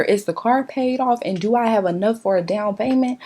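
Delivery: usual speaking voice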